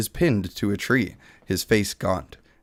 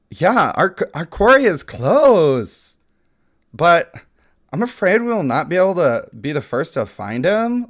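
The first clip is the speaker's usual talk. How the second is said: higher, lightly voiced Valley Girl; 5 semitones higher, 4 dB louder than usual. Valley Girl elf warns the party, a lightly higher Valley Girl voice. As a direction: keep the Valley Girl lilt going. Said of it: "We got the Valley Girl".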